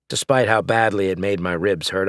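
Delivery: plain reading voice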